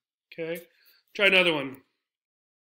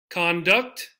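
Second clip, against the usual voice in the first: CON-duct, stressed on CON; first-syllable stress; 4 dB louder than usual. Shown as a demonstration. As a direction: hit "con" in conduct.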